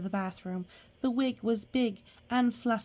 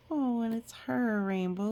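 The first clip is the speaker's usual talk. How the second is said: plain reading voice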